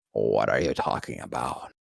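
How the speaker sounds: low, cold, unbothered; deep actor voice. Retold or imitated. Voice actor brushing off the kid's praise, low and cold. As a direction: drop the pitch and stay icy.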